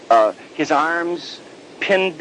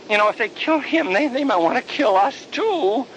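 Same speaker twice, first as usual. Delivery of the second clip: scared apostles; 10 semitones higher than usual. Acted. Scared apostles voiced high.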